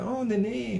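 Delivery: put-on high, soft cooing to a baby; baby talk. Parent cooing at newborn son, sing-song baby talk. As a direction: coo it like a silly parent to a newborn. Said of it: "falando ali igual bobo".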